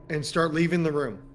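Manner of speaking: ordinary delivery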